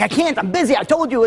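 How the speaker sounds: high-pitched, exasperated brush-off; retold irritation. Busy husband refusing his pleading wife, mildly angry. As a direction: imitate a husband getting angry, without shouting. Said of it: "he starts getting angry at her again".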